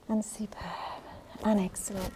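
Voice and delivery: breathless, airy praise between efforts; winded after the exercise; out of breath. Real, not acted. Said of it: "Oh, I sound very much out of breath".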